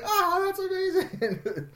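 high, slow squeal of delight; delighted little kid. Retold or imitated. Son loves dad's impression, high imitated squeal of delight. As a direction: imitate a child thrilled to bits.

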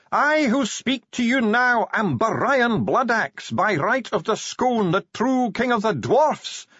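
very high, slow declamation; screamed proclamation. A narrator's reading. Dwarf relays the dead king's claim, screaming it out. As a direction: cry it out grandly, stretching the titles. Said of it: "Bashfulson screamed".

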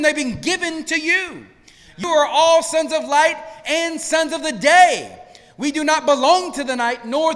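full-force preaching. Acted. Pastor urging the congregation, full-force preaching. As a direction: preach it out to the room.

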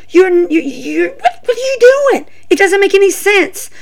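high, stammering exasperation; exasperated disbelief. Real, not acted. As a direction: voice climbs high in baffled protest. Exasperated at wrestler's gimmick change.

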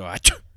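loud mock sneeze "Achoo"; fake sneeze. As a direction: burst out a pretend sneeze. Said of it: "oh, excuse me".

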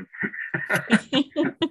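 bright burst of laughter; high laugh. Real, not acted. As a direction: laugh out loud, rising. Laughing at her own story.

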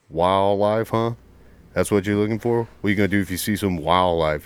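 loud mock drill-instructor challenge; drill instructor grilling. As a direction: throw it back at the recruit, loudly. Retold instructors mocking the recruit.

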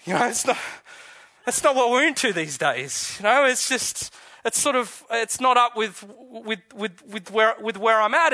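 a preacher lightly imitating a smug modern who shrugs off the resurrection; mock dismissive modern. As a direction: toss it off like a smug modern skeptic. Mocking the dismissive modern view.

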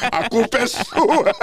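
slow, laughing accusation; laughing blame. Retold or imitated. Host blaming his stage director, slow and laughing. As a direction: drag it out through a laugh. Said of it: "ele olhou pra mim e falou".

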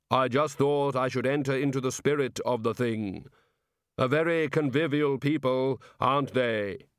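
measured, sonorous Reaper; Death. Acted. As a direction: intone it solemnly while making polite small talk. Death chats with Rincewind.

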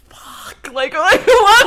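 whispered curse jumping to a high, loud squeal; reacting to the ex guessing the breakup; panicked shock. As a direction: caught off guard, half laughing. Panicked reaction to ex's text.

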